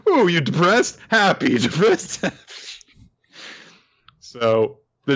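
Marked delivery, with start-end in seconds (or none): 0.1-2.4 higher playful voice through laughter; lightly hammed toying chant; teasing singsong. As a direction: flip him on and off for fun. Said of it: "Just like".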